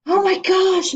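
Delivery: high, loud exasperated cry; exasperated yell. A narrator's reading. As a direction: throw up your hands and yell. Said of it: "Missus Jules yelled".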